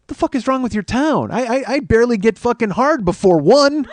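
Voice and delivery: exasperated rant. Acted. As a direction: rail at the town, pitch up and loud. Exasperated rant about early closing.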